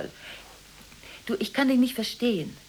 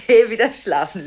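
much louder, sharp command; loud order; 12 dB louder than usual. Acted. Ordering Gigi loudly to go back to sleep.

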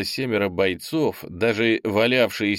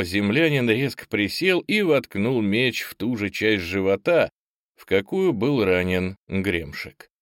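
usual speaking voice

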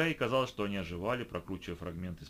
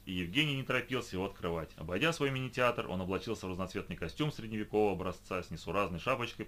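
usual speaking voice

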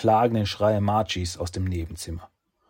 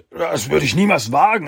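fearful protest; 8 semitones higher, 9 dB louder than usual. Acted.

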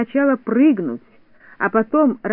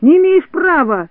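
high, loud protest; protesting shout; 6 semitones higher, 5 dB louder than usual. A narrator's reading. Neznaika being dragged from under the bench, protesting shout. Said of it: "кричал Незнайка".

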